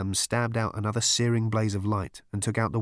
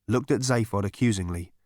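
ordinary delivery

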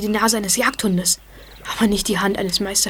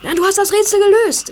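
higher, louder, thrilled; excited; 10 semitones higher, 6 dB louder than usual. Acted. Boy tells his friend he solved the riddle, excited. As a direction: burst out with the discovery.

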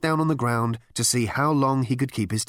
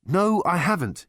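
higher, firmer denial; a little stronger; 8 semitones higher than usual. A narrator's reading. Ford addressing the captain, a little stronger. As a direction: push it a little more firmly. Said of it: "be a little stronger on the subject".

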